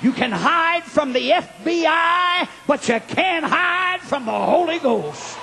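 high, slow, shouted revival climax; full-force preaching. Real, not acted. Preacher rousing the church to its feet, full-force preaching. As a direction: drive the congregation up to shout.

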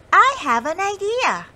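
high, loud excited mouse; grinning. Acted. Molly excitedly tells her friends her idea. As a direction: say it with a grin, bursting with the idea. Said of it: "Molly grinned".

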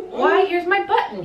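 high, lightly excited storybook cry; delighted exclamation. A narrator's reading. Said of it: "he cried".

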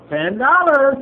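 shouting the win; loud, happy burst of joy; excited. Real, not acted. Scratch-off win.

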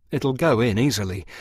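plain reading voice

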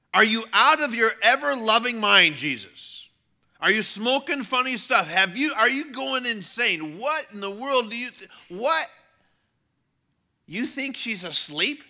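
loud, high outraged disbelief; incredulous scoffing. Acted. Mourners scoff at Jesus saying she sleeps, incredulous. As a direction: pile up the questions in loud disbelief.

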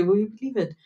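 ordinary talk, nothing marked

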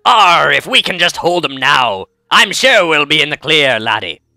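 slow, drawn-out pirate with a growled arr. Shown as a demonstration. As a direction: do a hammy pirate, stretching the words. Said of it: "That's my pirate voice".

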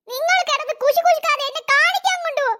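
put-on high kid voice, demanding; a spoiled girl ordering the adults; whiny cartoon brat; bratty child. Itchy child demands the doctor.

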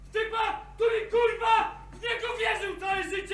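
high, loud, accusing shout; real heated yelling; angry reproach. Real, not acted.

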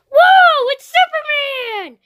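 high-pitched, loud squeal of awe; high cartoon fan-shriek; excitable Toad voice. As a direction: squeal it high like a thrilled puppet. Toad thrilled to see Superman.

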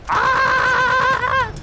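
long high loud "ah" scream; playful scream. Pretend. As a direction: burst into a drawn-out high-pitched yell. Sudden scream mid-sentence about laughs.

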